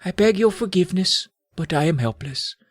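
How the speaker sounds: mock-tragic, half-whispered helplessness; whispered apology. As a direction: beg forgiveness in a lightly hushed character voice. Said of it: "he whispered".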